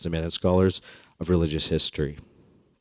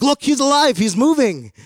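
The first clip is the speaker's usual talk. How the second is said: much higher, louder, hammed-up shopkeeper cry; mock excited exclamation; 5 dB louder than usual. Shopkeeper rattling the cage at the customer, hammed-up high excited cry.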